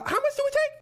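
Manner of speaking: squeaky nervous cop. Retold or imitated. Officer voiced in retold story.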